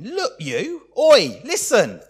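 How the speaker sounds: disrespectful son yelling for attention; insolent outburst. Acted. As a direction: shout down your father rudely. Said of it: "how offensively he speaks to his father".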